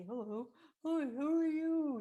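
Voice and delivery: very loud, high, whooping greeting; mock hysterical. Someone hysterical greets the mirror, loud high mock giddiness. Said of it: "Others think it's hysterical".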